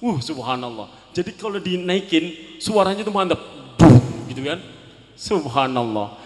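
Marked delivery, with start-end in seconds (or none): 3.8-4.6 engine roar. Retold or imitated. Imitating motor engine roar.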